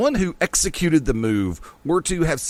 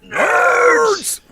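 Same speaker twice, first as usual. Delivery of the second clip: nerd yell; 7 dB louder than usual. Acted. Mocking Revenge of the Nerds call.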